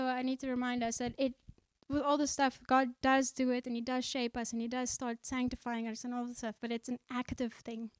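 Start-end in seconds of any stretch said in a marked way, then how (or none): none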